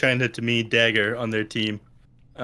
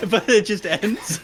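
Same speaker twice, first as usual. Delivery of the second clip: laughing; 9 semitones higher, 4 dB louder, slower than usual. Real, not acted. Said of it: "it seems like he's building up to a positive thing".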